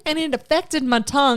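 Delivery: mock Southern accent. Co-host joining the pheromone joke, mock Southern accent. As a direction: put on a badly faked Southern accent. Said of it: "We're gonna butcher your accents now".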